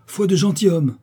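loud, high-pitched vow; fervent oath. A narrator's reading. The lover swears to his cousin, loud and fervent. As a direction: swear it emphatically on your honour.

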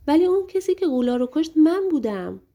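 high, loud outburst; can't hold it in any longer, burst out; shouting out. A narrator's reading. John bursting out to the princess with a loud, high cry. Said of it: "فریاد زد".

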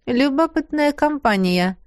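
light society-small-talk tone; urbane. Acted. Bracelet commenting on the guests, urbane. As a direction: toss it off like a polite remark at a ball. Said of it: "сообщил браслет светски".

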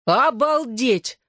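high, slow drawn-out yell; shout. A narrator's reading. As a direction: yell it right into her ear. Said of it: "заорала она мне в ухо". (протяжный высокий крик в ухо)

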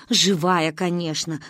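ordinary delivery, nothing marked

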